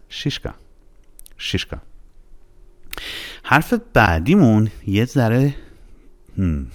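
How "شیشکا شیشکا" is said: "شیشکا" said softly with a simple ee; plain i. Shown as a demonstration. Teacher repeating the correct word for learners, plain i. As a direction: keep the first vowel of "شیشکا" light, as in shee-shka. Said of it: "یه ساده است".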